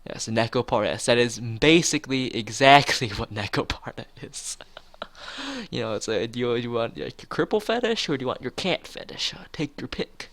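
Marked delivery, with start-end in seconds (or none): none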